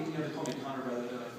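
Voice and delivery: normal talking voice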